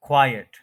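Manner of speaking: "क्वाइट" as KWAI-et, loud first syllable; two-syllable pronunciation. Shown as a demonstration. Teacher joining both parts for learners, stressed two-syllable pronunciation. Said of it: "एक ख्वाए है और फिर एक इट है".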